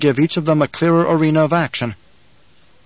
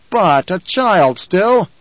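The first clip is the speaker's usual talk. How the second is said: high, slow protesting tribesman; objecting from above; 5 semitones higher, 4 dB louder, much slower than usual. Acted. Man in the rocks doubts the boy, objecting. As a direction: call the objection down to your leader.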